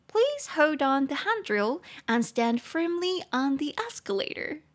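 slow, high announcer voice quoting the metro; metro announcement. Retold or imitated. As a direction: imitate the metro speaker slowly.

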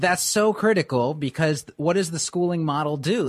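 ordinary talk, nothing marked

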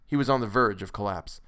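ordinary delivery, nothing marked

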